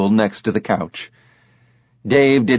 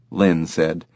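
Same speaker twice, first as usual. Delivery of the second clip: plain reading voice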